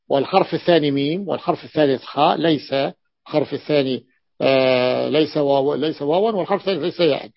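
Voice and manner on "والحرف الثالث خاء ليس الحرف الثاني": ordinary talk, nothing marked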